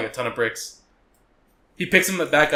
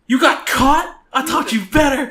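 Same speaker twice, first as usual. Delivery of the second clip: mock mother's angry scolding, higher and louder; a furious mom chewing out her son; 10 semitones higher, 6 dB louder than usual. Mom scolding her caught spy son, mock angry shout. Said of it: "That's fucking interrogators".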